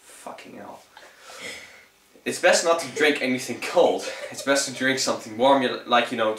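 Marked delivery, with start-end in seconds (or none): 0.0-0.8 much softer breathy swear under the breath; muttered aside. Real, not acted. Vlogger swearing quietly at a farting dog mid-video. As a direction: grumble it so the camera barely hears.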